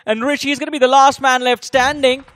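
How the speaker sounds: ordinary talk, nothing marked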